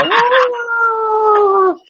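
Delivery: high sustained howl; coyote call. Retold or imitated. Imitating the dog's call to the neighbourhood, coyote howl. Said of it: "she'll do the coyote call".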